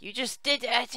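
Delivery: high-pitched mock complaint; mock viewer yelling. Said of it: "You guys are gonna yell at me".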